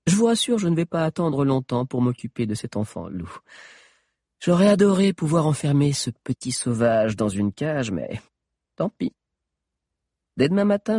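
high, loud, gloating menace; enjoy the cruelty; sadistic witch. Acted. Witch's long threat. (sorcière sadique et triomphante)

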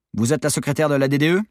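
usual speaking voice

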